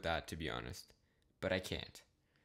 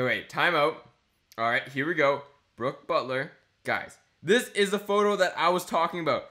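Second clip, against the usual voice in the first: ordinary delivery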